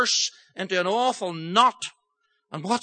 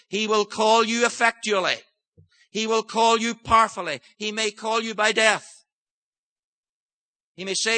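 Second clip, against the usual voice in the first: full-force preaching; 6 semitones higher than usual. Acted. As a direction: warn the hard-hearted congregation with rising intensity. Preaching warning of God's call.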